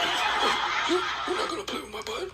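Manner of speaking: high, rapid, stammered protest; taken-aback customer. Acted. Customer objects to the barista's demand, high and hurried. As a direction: protest in a quick squeaky voice.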